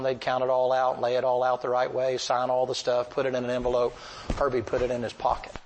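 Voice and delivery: normal talking voice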